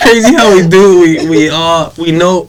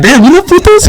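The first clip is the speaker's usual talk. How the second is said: surprised; 13 semitones higher, 3 dB louder than usual. Retold or imitated. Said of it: "I was like".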